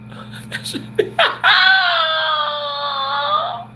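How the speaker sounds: laughing hard at the niece's pizza line; big laugh. Real, not acted. Bursts out laughing at the story.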